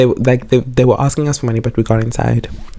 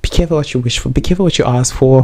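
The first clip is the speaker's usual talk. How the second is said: usual speaking voice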